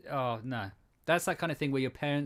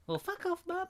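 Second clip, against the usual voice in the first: put-on much higher, soft child voice; eight-year-old; 5 dB quieter than usual. Eight-year-old telling mum off, high child voice. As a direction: put on a small kid's pipe. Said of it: "As I would have said as an eight-year-old".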